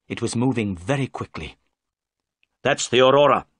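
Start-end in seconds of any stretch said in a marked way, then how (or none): 2.6-3.5 loud excited call; cried out. A narrator's reading. Holmes spots the Aurora and calls to the crew, loud. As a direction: spot the boat and call it out. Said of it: "cried Holmes".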